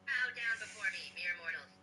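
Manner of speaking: normal talking voice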